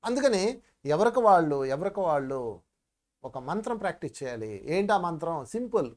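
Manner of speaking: ordinary talk, nothing marked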